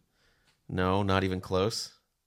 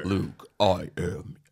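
Darth Vader voice; much slower than usual. Pretend. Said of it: "Luke, I'm your father".

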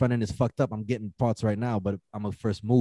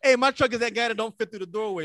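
high playful voice; 10 semitones higher than usual. Retold or imitated. Personifying truck.

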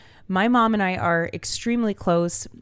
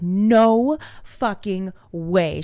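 slow, drawn-out disbelief; stunned disbelief; 3 dB louder, much slower than usual. Retold or imitated. Mom stunned to hear about the Florida water, slow disbelief. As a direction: let each word drop slowly, stunned.

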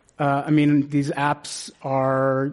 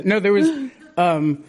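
ordinary delivery

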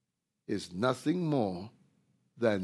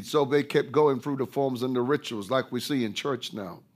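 ordinary talk, nothing marked

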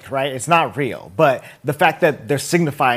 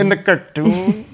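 mock voice; 5 semitones higher than usual. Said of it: "Can you stop that, please?".

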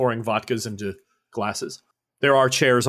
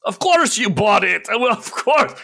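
higher, knowing laugh in the voice; mock disbelief; 8 semitones higher, 3 dB louder than usual. Official pretending to believe the pilot. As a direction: humor her, not believing a word.

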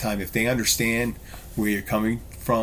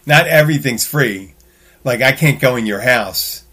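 emphatic; 4 semitones higher, 10 dB louder than usual. Real, not acted. Emphatic point, rising voice.

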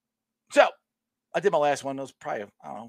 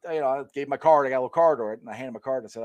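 ordinary talk

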